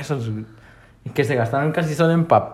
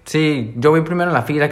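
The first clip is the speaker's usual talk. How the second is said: ordinary delivery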